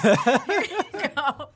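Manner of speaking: laughing. Real, not acted. Joking offer amid laughter.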